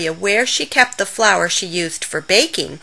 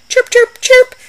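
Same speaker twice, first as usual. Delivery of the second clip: very high, loud chirp chirp chirp; bird chirps; 8 dB louder, slower than usual. Acted. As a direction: squeak it like little birds. Birds chirping at the pie.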